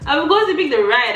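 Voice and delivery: "Of course you picked the ride" cried out high and loud; triumphant exclaim. Retold or imitated. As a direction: crow it out in triumph. Repeating her own retold line.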